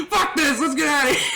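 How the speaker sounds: high mock shout breaking toward laughter; imitated angry exit. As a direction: storm off as the fed-up buddy, amused. Friend storms off in disgust.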